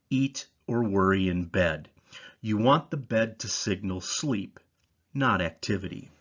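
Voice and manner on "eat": normal talking voice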